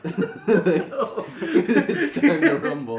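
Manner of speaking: high, stretched-out announcer call through laughter; laughing mock "time to rumble" announcement; boxing announcer. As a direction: belt it out like a ring announcer, very slowly.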